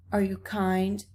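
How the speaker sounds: ordinary delivery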